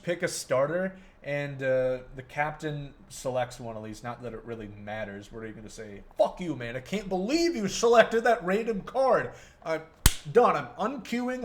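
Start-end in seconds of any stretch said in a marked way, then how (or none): 6.2-11.4 hammed-up angry teammate; mock angry rant. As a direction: fake outrage, rising pitch.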